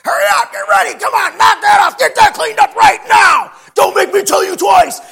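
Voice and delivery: put-on furious high yelling at the kids; screaming at the kids. Dad yelling at his kids to get ready. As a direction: bellow orders to hurry the kids along. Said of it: "heard me screaming like I do".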